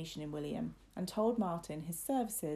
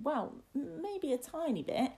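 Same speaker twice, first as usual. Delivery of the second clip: put-on high, halting child admission; hesitant boy; 8 semitones higher than usual. Boy confesses a little fear, high and hesitant. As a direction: admit it reluctantly, catching on the first sound.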